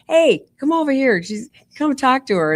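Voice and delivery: mock-holler as if hailing someone across a room; high-pitched imitation of calling someone over; retold yell. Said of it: "He literally yells across the floor".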